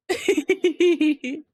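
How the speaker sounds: laugh. Real, not acted. Laughs after screaming.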